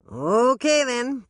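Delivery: loud, high hammed-up Southern accent; brash bad Southern accent greeting. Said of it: "a voice with a really bad Southern accent".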